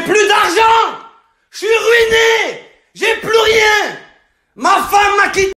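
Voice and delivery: worked-up anger. Acted. Ruined gambler explaining his anger to the tobacconist, furious yell. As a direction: wail each loss at full voice. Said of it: "je comprends pas pourquoi t'es énervé".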